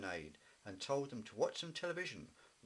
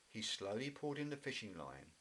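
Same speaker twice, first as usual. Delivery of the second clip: ordinary delivery, nothing marked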